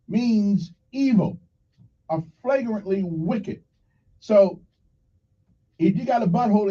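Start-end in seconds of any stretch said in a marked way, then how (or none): 0.1-3.6 means evil or flagrantly wicked, said slowly; slow, deliberate teacher read; slow definition. Shown as a demonstration. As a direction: read the meaning slow and clear. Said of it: "listen closely".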